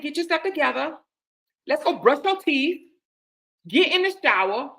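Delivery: no-nonsense big-sister bossing; rough and firm. Retold or imitated. Telling her friend to clean up, firm rough orders. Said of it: "talk to her in a rough kind of way".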